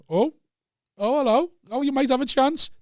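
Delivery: high, teasing surprised oh hello; mock surprise. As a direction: act falsely amazed, voice up high. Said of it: "was like".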